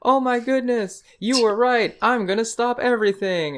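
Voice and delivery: put-on high, sweet girlish voice; playing the girl who suddenly agrees; mock girly relief. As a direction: squeak it out like a relieved schoolgirl. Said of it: "And then she's like".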